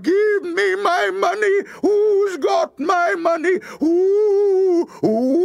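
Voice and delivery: very high, loud ghost wail; ghostly cry. A narrator's reading. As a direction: demand it in a drawn-out wail. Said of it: "And the woman cried".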